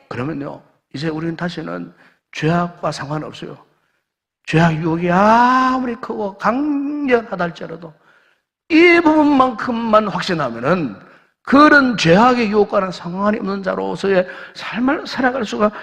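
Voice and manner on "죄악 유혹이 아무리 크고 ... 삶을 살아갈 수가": high, loud, slowed pulpit declaration; preaching at full force. Acted. Pastor assuring the congregation, preaching at full force. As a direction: proclaim it with full conviction.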